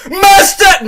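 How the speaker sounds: sudden high, very loud yell; explosive playful scream; mic-blowing shout. Pretend. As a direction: belt it out over the top. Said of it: "I'm not gonna blow out my mic".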